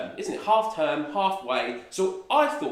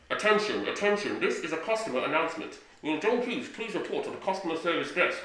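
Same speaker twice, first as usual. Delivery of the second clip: store loudspeaker announcer voice; tannoy announcement. Retold or imitated. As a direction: read it like a supermarket PA. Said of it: "when that announcement was like".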